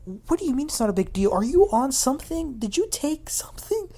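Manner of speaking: high-pitched retold disbelief; incredulous. Voicing a castmate challenging the brush-off, incredulous.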